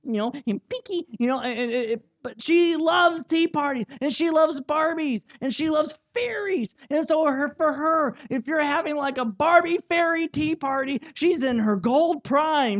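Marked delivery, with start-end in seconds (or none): none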